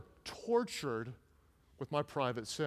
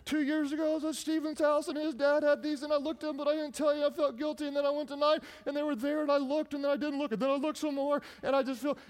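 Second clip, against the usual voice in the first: put-on high-pitched rushed child pouring it all out; boy confessing everything to his mom in a high voice; tearful boy confessing; 10 semitones higher, faster than usual. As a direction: spill the whole story like a scared kid. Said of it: "I said".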